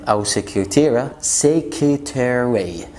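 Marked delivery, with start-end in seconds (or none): 1.2-2.9 secretary with the middle R left out, final R kept; slow careful demo of سكرتاري without the middle R. Shown as a demonstration. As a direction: say سكرتاري slowly, dropping the middle R. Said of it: "ما تنطقش ال R اللي في النص".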